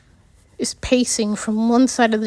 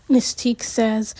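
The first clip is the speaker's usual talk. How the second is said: usual speaking voice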